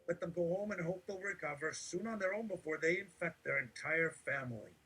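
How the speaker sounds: high-pitched preachy voice; preaching. Acted. Raised sermon voice.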